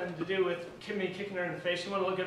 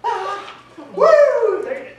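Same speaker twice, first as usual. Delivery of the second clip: excited whoop; 11 semitones higher, 15 dB louder, slower than usual. Retold or imitated. Improvising dance, whooping.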